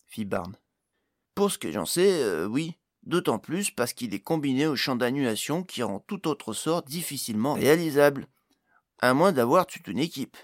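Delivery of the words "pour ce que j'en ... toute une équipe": lightly voiced gnome expert; lively gnome character voice; explaining gnome. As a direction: explain it as a gnome, a touch louder.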